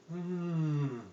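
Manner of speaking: soft content hum; savoring hmm. Acted. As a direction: hum like biting a donut. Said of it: "And then again".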